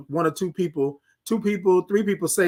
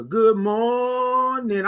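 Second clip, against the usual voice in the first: slow, high stretched "Good morning"; singsong stretched "Good morning"; drawn-out good morning; 5 semitones higher, much slower than usual. Acted. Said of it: "drawn greeting".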